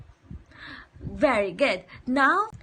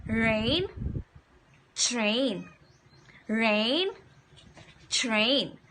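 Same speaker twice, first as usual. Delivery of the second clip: "Rain" rising, "train" falling, each said slowly apart; slow model words; 3 semitones lower, much slower than usual. Shown as a demonstration. Teacher modelling words for children to echo, slow and clear. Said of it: "Can you say it with me?".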